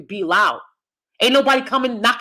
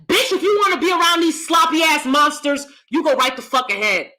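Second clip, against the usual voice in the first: angry shout; 5 semitones higher than usual. Real, not acted. Venting at family, shouting.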